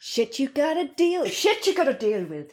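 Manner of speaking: mock Irish, badly done; a hammed-up Irish lilt; awful Irish accent. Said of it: "this awful Irish accent".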